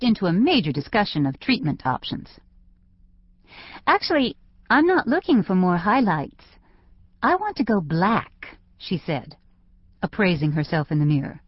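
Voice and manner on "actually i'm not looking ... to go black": lightly voiced teen, higher pitched; teenage client. A narrator's reading. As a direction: state your wish as the young client. Teen client states her wish.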